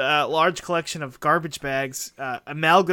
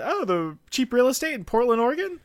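higher quoted voice; 7 semitones higher than usual. Retold or imitated. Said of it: "and I said".

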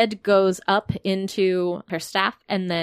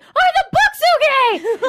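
high, slow, laughing panic; squeaky panicked character; 7 dB louder than usual. Acted. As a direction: squeak it out in alarm. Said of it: "Zephyr's like".

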